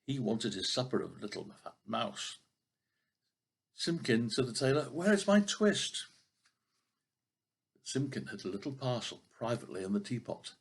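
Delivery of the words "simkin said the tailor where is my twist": demanding tailor. Acted. Tailor demands twist again.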